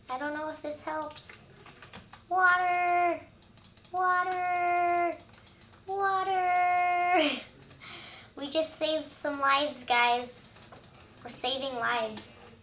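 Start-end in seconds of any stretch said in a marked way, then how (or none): 2.3-7.3 higher, louder calls for water; urgent shout. Real, not acted. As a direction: yell for water to put out the fire.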